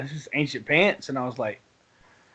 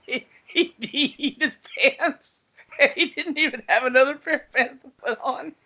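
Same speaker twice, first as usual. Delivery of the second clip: high breathless laughter through words; can barely speak for laughing; 9 semitones higher than usual. Real, not acted. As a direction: wheeze the words out mid-laugh. Cracking up over friend's pants.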